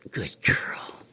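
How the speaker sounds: breathy whisper of praise. Real, not acted. Owner whispering praise to her parrot. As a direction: whisper it to the bird.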